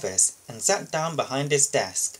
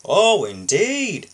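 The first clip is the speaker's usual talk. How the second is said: very slow, higher and louder "Oh, indeed"; sceptical boss; 6 semitones higher, 7 dB louder, much slower than usual. Acted. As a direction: stretch it out, unconvinced.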